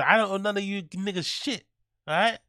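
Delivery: hammed-up American tough talk; American accent. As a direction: put on your best American accent. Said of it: "do my best American accent".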